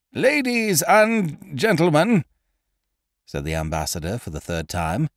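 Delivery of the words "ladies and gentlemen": high, slow stage announcer shout over the crowd; announcer's call. Acted. Compère calling to a restless music-hall crowd, high and drawn out. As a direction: call the house to order from the stage. Third try, said the ambassador.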